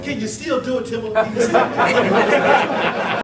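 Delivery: high, loud quote broken by laughter; laughing plea. Retold or imitated. Retelling a teammate's plea, laughing hard. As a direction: beg him through your own laughter. Teammate begging to see the move.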